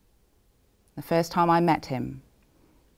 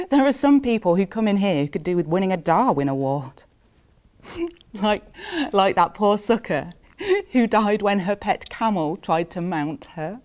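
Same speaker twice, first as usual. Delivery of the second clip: higher, amused telling broken by chuckles; laughing through it; 4 semitones higher, 3 dB louder than usual. Acted. Death recounting absurd deaths of those who come in, laughing. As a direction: struggle to hold back laughter while telling it.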